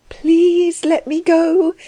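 high, slow, drawn-out plea; helpless, high-voiced begging; faint entreaty. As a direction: entreat weakly, high and stretched out. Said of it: "she entreated faintly".

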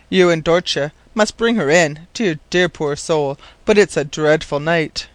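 plain reading voice

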